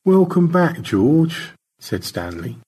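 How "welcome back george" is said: a touch higher voice; lightly voiced character. Stanley welcomes George back, lightly voiced greeting. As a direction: greet him with mild cheer.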